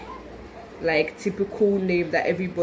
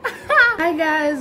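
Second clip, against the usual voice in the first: coming through shout; 10 semitones higher, 6 dB louder than usual. Real, not acted. Bursting in with vlog greeting.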